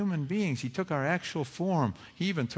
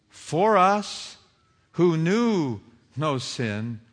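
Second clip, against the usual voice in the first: scripture read slowly, for us lifted and louder; slow awed reading; 4 semitones higher, 6 dB louder, slower than usual. Real, not acted. Preacher reads the verse to his flock, slow and awed. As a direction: linger on every word in wonder. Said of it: "I can hardly believe it whenever I read it".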